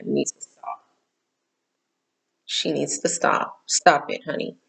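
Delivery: muttered private aside; whispered aside. Real, not acted. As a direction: hush it under your breath as if scolding the slipping top. Scolding her slipping top aside.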